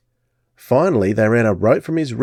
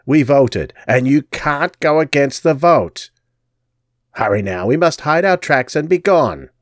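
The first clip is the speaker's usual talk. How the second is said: scolding crone; 4 semitones higher than usual. Acted. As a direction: bark the orders.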